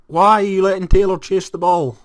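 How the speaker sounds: high, loud exasperated shout; annoyed manager shouting at a player; frustrated yell. Real, not acted. Yelling at own player.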